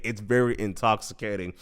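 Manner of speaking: usual speaking voice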